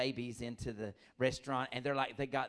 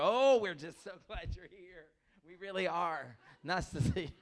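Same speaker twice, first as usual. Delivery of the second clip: slow, louder, higher mock greeting; hammed-up church greeter; 4 semitones higher, 5 dB louder than usual. A comic mocking a nervous greeter, slow and loud. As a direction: gush over the newcomer while fearing germs. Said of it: "You go".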